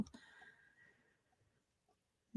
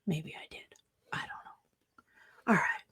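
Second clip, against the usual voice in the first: sarcastic whisper; 4 semitones lower, 12 dB quieter than usual. Acted. Sarcastic muttered aside.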